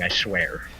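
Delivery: ordinary delivery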